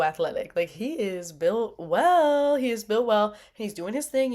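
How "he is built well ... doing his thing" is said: ordinary talk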